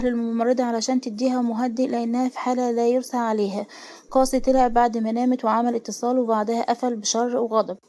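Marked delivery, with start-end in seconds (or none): none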